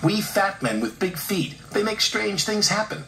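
playful, high storybook engine; teasing engine. A narrator's reading. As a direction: spin a spooky legend to tease. Douglas teasing with a legend.

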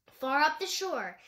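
plain reading voice

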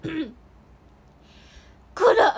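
loud burst of mascot voice, cut short; My Melody. Acted. False start of a mascot impression for viewers, loud character voice. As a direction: start a big cartoon-character call. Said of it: "クロミちゃんのモノマネ".

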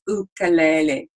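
"ukulele" with oo at the start; real Hawaiian pronunciation. Shown as a demonstration. Teacher repeats the real Hawaiian pronunciation. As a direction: say "ukulele" as oo-koo-LEH-leh. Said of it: "the real pronunciation is".

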